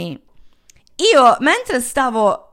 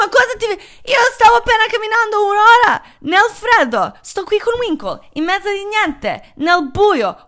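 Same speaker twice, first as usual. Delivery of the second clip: exasperated outburst; 10 semitones higher than usual. Retold or imitated. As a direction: pitch it up in disbelief, she walked an hour for nothing. Furious at drunk boyfriend on phone.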